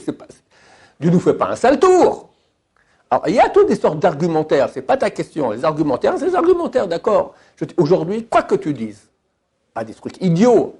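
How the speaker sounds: ordinary delivery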